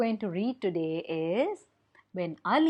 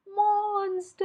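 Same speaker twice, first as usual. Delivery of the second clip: high, frightened cry of monsters; the owl shrieks a warning, high; owl cries monsters; 11 semitones higher than usual. A narrator's reading. Owl warns the monkey, high frightened cry. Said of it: "and he said".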